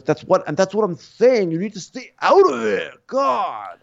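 high, strained mock anger; imitated exasperated outburst. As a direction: argue back, fed up. Acted quarrel, man fed up.

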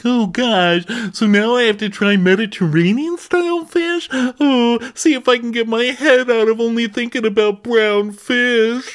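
very high whimpering lament; whiny insecure chef. Retold or imitated. Podcaster imitating chef confessing insecurity to crew, high and whiny. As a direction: pout through it like a hurt child. [name] voiced, fretting.

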